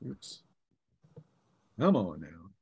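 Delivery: ordinary talk, nothing marked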